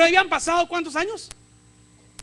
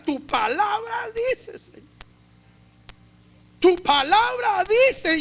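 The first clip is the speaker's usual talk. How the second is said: fervent prayer; 5 semitones higher, 4 dB louder than usual. Acted. Voicing Moses' fervent prayer.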